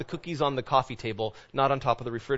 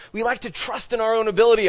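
ordinary delivery

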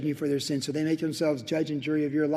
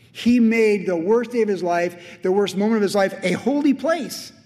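full-force preaching; 5 semitones higher, 6 dB louder than usual. Acted. Preacher proclaiming the worst day holy, full-force preaching. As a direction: proclaim it big, rising to the end.

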